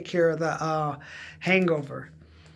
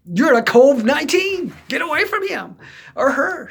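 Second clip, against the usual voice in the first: mock alarm; 9 semitones higher, 7 dB louder than usual. Friends shunning him.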